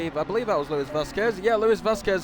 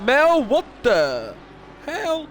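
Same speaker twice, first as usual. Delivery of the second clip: higher, slowed, stunned disbelief; shocked outburst; 7 semitones higher, much slower than usual. Real, not acted. Commentator to viewers, startled by the crash, stunned disbelief. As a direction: caught off guard by what just happened on track.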